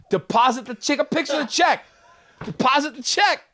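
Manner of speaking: loud, high, urgent repeated order; frantic insistence. Retold or imitated. Comic insisting to baffled wife, frantic shouted order. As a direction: yell it in mock panic.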